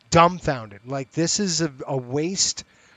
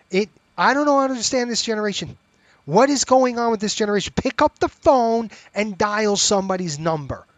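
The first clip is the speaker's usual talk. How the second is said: higher, louder, fast venting; exasperated rant; 6 semitones higher, 6 dB louder than usual. Real, not acted. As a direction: fire it off at this generation. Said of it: "That's my rant".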